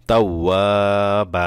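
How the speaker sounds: তাও ওয়া বা with long ওয়া and long open বা; drawn-out stop. Shown as a demonstration. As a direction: hold the closing বা without an n. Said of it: "এভাবে". (তাও ওয়া বা, শেষে বা টেনে থামা)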